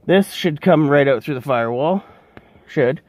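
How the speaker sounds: should leaned on, then repeated; stress on should. Real, not acted. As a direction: hit should, then echo it. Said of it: "Should, being the key word".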